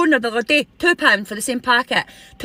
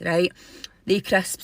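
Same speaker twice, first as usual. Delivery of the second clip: usual speaking voice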